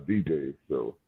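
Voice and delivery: usual speaking voice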